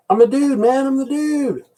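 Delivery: high mock Dude voice; Dude impression. Talking to viewers, he mimics the Dude in a high mock voice. Big Lebowski quote impression.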